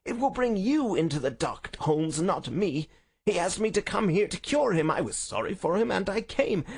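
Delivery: high, indignant villain's snarl. A narrator's reading. Arrested villain accusing the detective, high snarling indignation. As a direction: protest high and heated, vowels stretched. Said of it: "cried the high, snarling voice".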